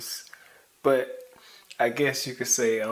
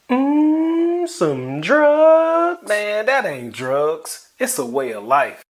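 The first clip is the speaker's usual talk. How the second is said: higher, louder mock offer; high loud character voice; 7 semitones higher, 9 dB louder, slower than usual. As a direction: play a squeaky tempter.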